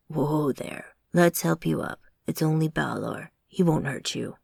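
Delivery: low, calming deep voice; steady her gently, deep and low; big man soothing. A narrator's reading. Big man calms startled Amanda, low soothing voice. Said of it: "the big man said".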